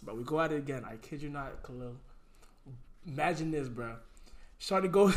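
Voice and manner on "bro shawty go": usual speaking voice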